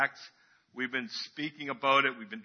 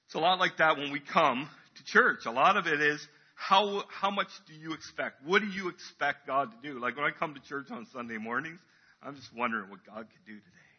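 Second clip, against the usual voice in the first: ordinary talk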